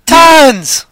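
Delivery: loud high exclamation; exclaiming. Real, not acted. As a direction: blurt it out big. Exclaiming about money.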